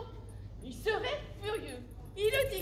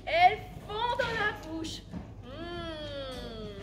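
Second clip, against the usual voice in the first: savouring mmm; 2 semitones lower, 3 dB louder, much slower than usual. Acted. Savouring the partridge.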